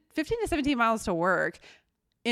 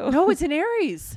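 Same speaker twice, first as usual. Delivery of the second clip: indignant; 10 semitones higher, 7 dB louder than usual. Real, not acted. Arguing the astrology mixup.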